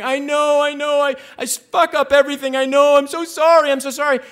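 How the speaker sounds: high-pitched, wailing apology; distraught apology. Retold or imitated. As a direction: imitate a woman breaking down and apologizing. Voicing her tearful apology.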